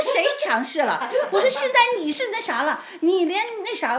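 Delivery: very high, loud comeback; acted indignant retort. Wife answering husband's accusation, acted indignant complaint. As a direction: fire the accusation back in a replayed quarrel. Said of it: "我就有点儿就埋怨他了".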